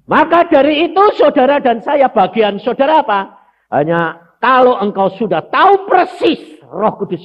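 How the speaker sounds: full-force preacher; preaching at full force. Acted. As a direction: drive the point home to the congregation. Preaching the point.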